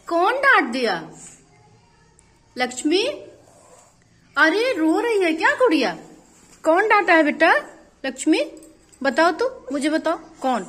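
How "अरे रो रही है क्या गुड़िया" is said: ordinary delivery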